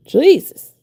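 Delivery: high, loud exasperated Jesus; exasperated cry. Real, not acted. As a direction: cry it out, rising. Exclaimed at the mess.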